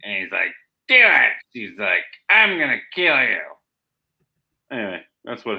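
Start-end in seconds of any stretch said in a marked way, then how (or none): 0.9-1.3 high, loud mock wail; hammed-up bawling; crying little baby. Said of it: "cries like a little baby". 2.3-3.4 high, loud hammed-up threat; exaggerated raised-voice menace; mock threatening yell. Acted threat in retold comic scene.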